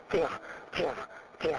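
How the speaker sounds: 加 pushed out hard with a strong burst of air; forced-out sound. Shown as a demonstration. Teacher turning the earlier sound into a forced one, 加 pushed out with strong breath. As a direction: force the breath out on each separate 加. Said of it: "然后用力出声音".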